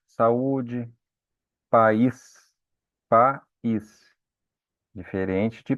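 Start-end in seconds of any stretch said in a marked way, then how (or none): 1.7-2.5 "país" as pa-ÍS, the i stressed; two syllables, stressed i. Shown as a demonstration. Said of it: "temos uma separação ali". 3.1-4.1 "país" broken in two, pa... ÍS; syllables separated. Shown as a demonstration. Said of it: "temos uma separação ali".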